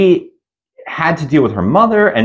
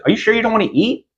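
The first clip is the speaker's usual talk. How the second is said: much higher, fast and sugary; too friendly; 10 semitones higher, faster than usual. Retold or imitated. Friend fussing over Carly, high and sugary. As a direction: chirp it sweetly and quickly.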